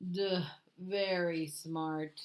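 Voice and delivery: slow, dopey bear voice with a dull duh; a lumbering, simple-minded bear; slow dim bear. A narrator's reading. Bear slowly adding praise for Fox.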